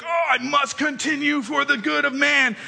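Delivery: mock heroic. Gift-opener with a paper cut, mock heroic. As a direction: play the wounded hero lightly.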